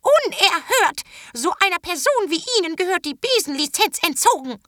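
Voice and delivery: put-on a scandalised old witch shrieking after them; indignant old witch. A narrator's reading. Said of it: "Die Hexenoma rief Terras Mutter hinterher".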